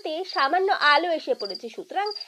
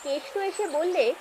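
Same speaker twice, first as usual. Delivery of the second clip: ordinary delivery, nothing marked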